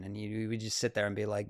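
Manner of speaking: usual speaking voice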